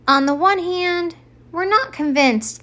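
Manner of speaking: lightly voiced reindeer, high start then easing; a hint of a thoughtful reindeer; Donner voice. Donner tells Santa the verdict, lightly voiced.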